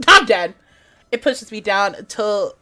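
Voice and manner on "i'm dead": laughing. Real, not acted.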